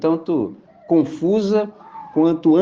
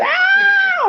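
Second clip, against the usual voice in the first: high-pitched mock scream of terror; 5 dB louder than usual. Preacher mimicking terrified disciples, a loud high scream. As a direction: shriek like a panicked fisherman.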